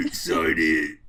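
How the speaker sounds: low, loud goofy villain voice; deep character voice. Acted. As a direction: drop way down and boom it out. Voicing the new character Gotmail.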